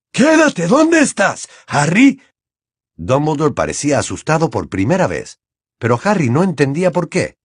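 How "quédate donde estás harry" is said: high, loud, slow order; thunderous command. A narrator's reading. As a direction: boom the command. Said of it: "le ordenó con voz atronadora". (orden atronadora, lenta y fuerte)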